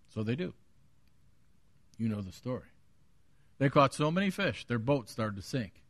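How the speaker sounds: normal talking voice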